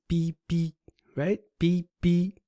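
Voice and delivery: "B B" held high and level on both syllables; first tone twice. Shown as a demonstration. Teacher matches the word to its tone pair, high level "B B". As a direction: say "B B" on two high, even tones. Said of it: "first tone, first tone".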